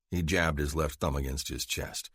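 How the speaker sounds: usual speaking voice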